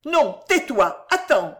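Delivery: slow, loud, urgent check; sudden change of tone. A narrator's reading. Mother abruptly silencing her son, urgent. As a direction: stop him short, suddenly resolved. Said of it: "changeant de ton tout à coup".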